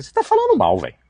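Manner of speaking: sudden high, loud retort; calling it out. Acted. Preacher calling out the gossiper, high and loud. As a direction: snap back high and loud at the excuse.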